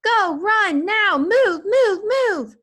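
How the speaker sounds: high, loud urgent commands; father herding family out in panic; panicked shouting. A narrator's reading. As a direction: drive them out yelling. Father yelling while running.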